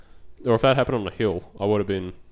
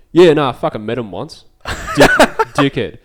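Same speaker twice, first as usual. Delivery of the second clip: imitating the driver laughing through the insult, louder; laughing truckie; 5 semitones higher, 11 dB louder than usual. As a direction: chuckle out the word dickhead. Driver laughing while insulting Trudeau.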